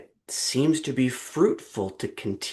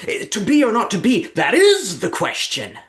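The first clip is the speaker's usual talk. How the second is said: very high, loud odd voice from the audience; hammed-up audience member voice; imitating Rory Kinnear; 13 semitones higher, 9 dB louder than usual. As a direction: heckle it in a strange high voice. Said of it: "who says".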